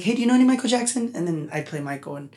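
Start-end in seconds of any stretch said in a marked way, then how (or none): 0.0-1.1 nurse's request. Retold or imitated. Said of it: "Or sometimes they'd be like".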